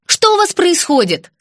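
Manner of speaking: loud call from the hallway. Acted. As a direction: shout from another room. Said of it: "крикнула из коридора Антонина".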